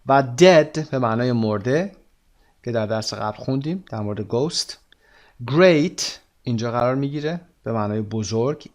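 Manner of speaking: ordinary talk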